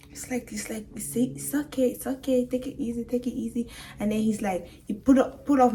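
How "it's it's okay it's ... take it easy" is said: softer, slightly raised soothing voice. Retold or imitated. Nurse to the crying crash victim, soothing. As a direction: imitate someone gently calming a patient.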